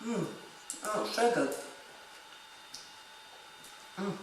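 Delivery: talking with a full mouth; words round a mouthful of soup; mouth full of hot food. Real, not acted. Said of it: "Full mouth".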